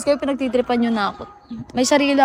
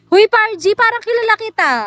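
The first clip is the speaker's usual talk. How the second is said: excited call; 8 semitones higher, 3 dB louder than usual. Real, not acted. Greeting viewer excitedly.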